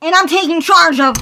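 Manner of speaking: shouted high, declaring freedom; defiant loud outburst; loud high rant. Acted. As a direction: yell it high and loud, taking charge. Character rebels loudly.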